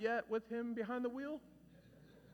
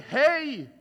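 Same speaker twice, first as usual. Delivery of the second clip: calling out; 8 semitones higher, 13 dB louder than usual. Retold or imitated. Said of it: "be the one who's like".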